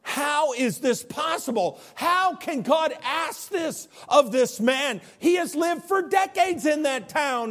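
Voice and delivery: high, slow, incredulous preacher; impassioned preaching. Acted. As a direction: cry out the questions in disbelief. Preacher asks incredulous questions.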